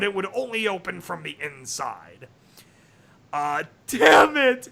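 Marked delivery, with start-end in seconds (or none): 3.3-4.7 louder, slow frustrated snarl; snarled curse. Acted. Sunset at the locked door, slow angry snarl. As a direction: spit it out in frustration. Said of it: "she snarled".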